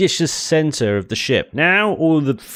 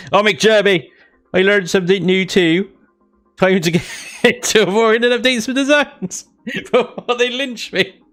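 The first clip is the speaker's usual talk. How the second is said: put-on higher, broad Bristolian accent, laughing through it; 10 semitones higher than usual. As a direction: lay on a thick Bristol accent. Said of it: "gave you a massive Bristolian accent".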